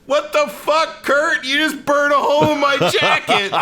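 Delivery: high, indignant put-on voice; mock outrage. Dad scolding Kurt Russell over his burned jacket, high mock outrage. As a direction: squawk it like the wronged dad. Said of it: "And he's like".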